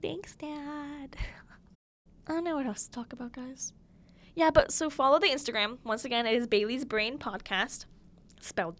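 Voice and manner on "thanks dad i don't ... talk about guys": whispering. Real, not acted. Whispered reply then aside.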